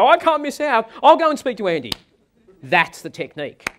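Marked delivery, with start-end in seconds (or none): 0.0-1.9 much higher, louder, gushing; eager excited customer. Retold or imitated. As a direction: burst out with eager alarm. Customer Angela reacts eagerly.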